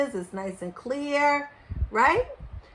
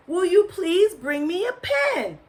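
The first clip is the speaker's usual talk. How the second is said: higher-pitched, insistent request; exasperated father; 9 semitones higher, 4 dB louder than usual. A narrator's reading. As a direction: repeat it with growing impatience.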